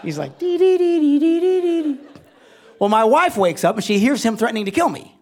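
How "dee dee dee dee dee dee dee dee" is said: high, loud rapid-fire "dee dee dee"; mimicked manic babble. Pastor imitating his brother's manic break, high loud babble. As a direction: rattle out high-pitched nonsense syllables like a siren.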